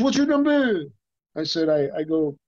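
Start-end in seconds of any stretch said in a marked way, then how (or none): 0.0-0.8 higher, quick and eager; imitating Jean-Claude asking for his number; light impression of an eager friend. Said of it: "he goes".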